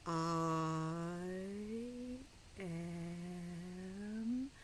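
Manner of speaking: each word with long pauses between; reading very slowly. Shown as a demonstration. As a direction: drag it out as slow as a snail. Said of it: "I'm reading it slowly because that's what it says".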